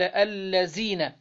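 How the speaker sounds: الذين said slowly with z for the dh sound, alladhīna as allazīna; slow demo of الذين mispronounced with z; z instead of dh. Shown as a demonstration. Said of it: "بالزاي بدل الذال".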